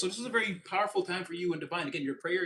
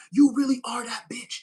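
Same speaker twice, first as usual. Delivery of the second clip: louder, punchy sassy praise; loud sassy hype; 8 semitones higher, 9 dB louder than usual. Pretend. Reader hyping up viewer, loud sassy praise. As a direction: shout the compliment with attitude. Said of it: "I don't even talk like that".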